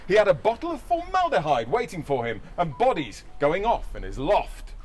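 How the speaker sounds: ordinary talk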